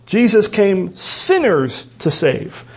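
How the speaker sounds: slow demonstration with SINNERS stretched and accented; deliberate word-by-word reading, main stress on sinners; emphatic SINNERS. Shown as a demonstration. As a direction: set "sinners" apart and lean on it. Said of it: "that's how it emphasizes".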